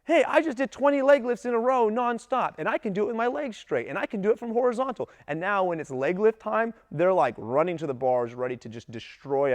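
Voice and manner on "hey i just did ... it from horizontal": put-on much higher, louder proud kid voice; bragging kid. Coach imitating an eager athlete bragging about leg lifts, high and loud. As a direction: show off like a kid who just nailed it. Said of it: "that kid who's like".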